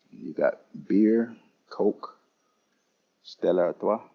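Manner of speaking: usual speaking voice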